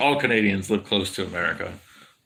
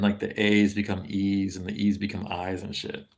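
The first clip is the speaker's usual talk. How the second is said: usual speaking voice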